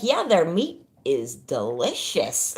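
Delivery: deadpan guy, laughing. Retold or imitated. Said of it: "He's like".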